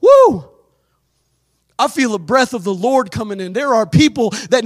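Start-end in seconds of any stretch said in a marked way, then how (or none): 0.0-0.4 high, loud exultant whoop; excited woo. Real, not acted. Preacher feeling the Spirit, loud joyful whoop. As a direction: let out a joyful holler.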